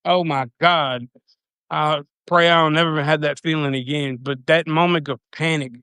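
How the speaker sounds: normal talking voice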